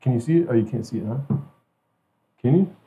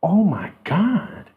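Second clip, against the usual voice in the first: ordinary delivery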